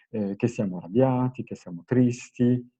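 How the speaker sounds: ordinary talk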